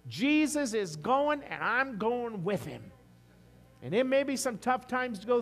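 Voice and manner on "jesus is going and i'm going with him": slow, much higher and louder cry; full-force preaching. Acted. Pastor rousing the congregation against their troubles, slow loud shout. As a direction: shout it out in triumph.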